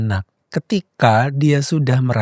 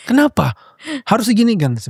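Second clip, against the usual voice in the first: higher, pushy parent demanding answers; 8 semitones higher than usual. Retold or imitated. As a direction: rush the child for a fix. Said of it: "ingin segera memberikan solusi". (orang tua yang terburu-buru memberi solusi, nada tinggi)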